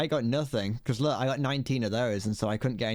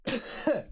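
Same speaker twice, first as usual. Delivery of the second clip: sharp dusty cough; coughing on dust; 12 semitones higher than usual. Real, not acted. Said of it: "I just inhaled dust".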